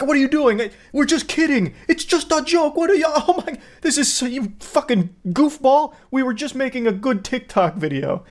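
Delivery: high-pitched mocking imitation of an indignant prankster; mock whining prankster. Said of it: "they're like".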